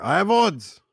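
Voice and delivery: high, loud call across the room; yelling from the bar. Acted. Gambler yelling his bet across the tavern, high loud shout. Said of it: "I yell from the bar".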